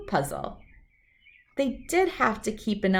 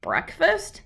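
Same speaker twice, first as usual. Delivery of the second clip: puzzled Brother; 9 semitones higher than usual. Acted. Brother puzzled by bats' breakfast.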